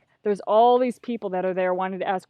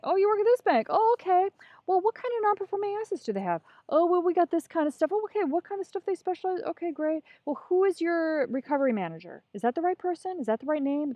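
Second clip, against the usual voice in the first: high-pitched, innocent small talk; chirpy schmoozing; 8 semitones higher than usual. Retold or imitated. Conference crasher chatting up a banker at the bar, chirpy schmoozing. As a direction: play friendly and curious to draw out information.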